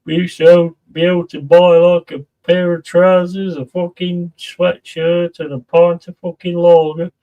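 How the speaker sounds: hammed-up "in my day" nostalgia; old-timer reminiscing. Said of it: "makes me sound like someone who's going".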